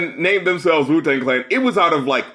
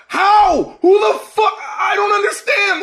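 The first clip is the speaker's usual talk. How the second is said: angry shout; 14 semitones higher than usual. Real, not acted. Host fumes at the singer's video, shouting in disbelief. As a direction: yell it in real outrage.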